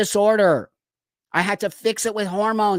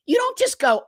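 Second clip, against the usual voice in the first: incredulous. Real, not acted. Mocking incredulous rant at TikToker.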